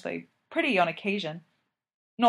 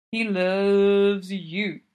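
put-on drunk; 6 dB louder, much slower than usual. A narrator's reading. Drunk John insists.

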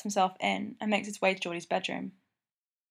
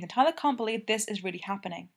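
ordinary delivery

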